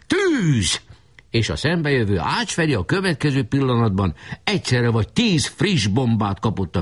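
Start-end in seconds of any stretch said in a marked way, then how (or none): 0.1-0.8 high one-word command; shouting. A narrator's reading. Leader orders his artillery to fire, high shout. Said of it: "hogy elkiáltassa magát".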